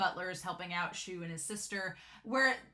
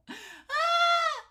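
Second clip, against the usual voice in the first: high delighted squeal; 6 dB louder than usual. Real, not acted. Fan squeal at the scene.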